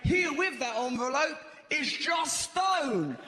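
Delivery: booming showbiz announcement; grand awards-show introduction; announcer voice. Retold or imitated. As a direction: announce her like a host on stage. Announcing at the Brits.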